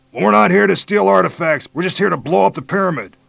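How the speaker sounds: high, loud command; shouting. A narrator's reading. Major challenging hidden attackers, loud shout. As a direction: shout the demand into the trees.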